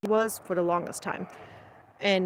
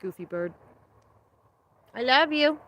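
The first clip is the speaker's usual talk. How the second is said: tender; 3 semitones higher, 3 dB quieter than usual. Real, not acted. Cooing to the goose.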